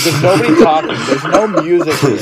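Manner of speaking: half-shouting in an oddly silent café; retold yelling. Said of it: "I start screaming".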